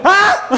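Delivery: short, loud high yelp; startled mock scream. Student spotted by the professor, comic startled high yelp. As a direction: yelp as if caught out.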